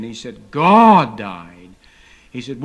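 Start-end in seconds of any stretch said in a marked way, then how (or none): 0.5-1.6 loud, indignant outburst; shocked, furious retort; righteous indignation. Retold or imitated. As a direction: explode at her in outrage. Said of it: "And he exploded with righteous indignation".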